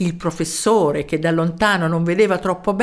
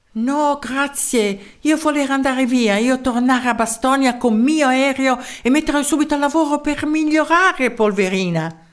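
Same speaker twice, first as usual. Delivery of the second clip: higher-pitched foreigner in broken Italian; foreign professor; 6 semitones higher than usual. A narrator's reading. Foreign professor declining the mayor's invitation, higher-pitched accented voice.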